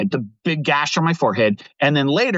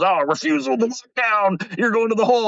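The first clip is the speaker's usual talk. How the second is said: much higher mock guard barking the rule; guard's order; 8 semitones higher than usual. Guest mimics guards sending him to the hole, higher. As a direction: ham up the goon squad's order. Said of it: "the goon squad comes".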